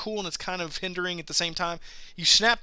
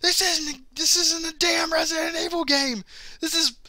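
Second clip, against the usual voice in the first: mock angry friend; 10 semitones higher than usual. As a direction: drag it out like a sulking gamer. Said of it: "He's like".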